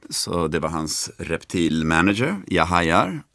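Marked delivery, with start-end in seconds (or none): none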